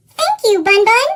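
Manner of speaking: high, loud happy thanks; grateful thanks. Acted. Child thanking Bun Bun for a gift, loud and happy. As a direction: beam your thanks to the secret cupid.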